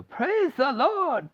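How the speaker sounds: high, slow exultant call; joyful cry. Acted. Grandpa Joe rejoicing, high slow exultant call. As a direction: sing it to the rafters.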